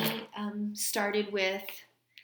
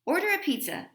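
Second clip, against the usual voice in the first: eager suggestion; 8 semitones higher, 5 dB louder, faster than usual. Acted. Father calls out suggestion.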